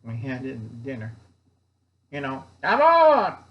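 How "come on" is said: much higher and louder cry of disbelief; exasperated shout. Real, not acted. Uncle Al ranting at viewers about NATO, loud exasperated shout. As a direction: belt it out, fed up.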